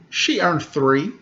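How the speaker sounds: plain reading voice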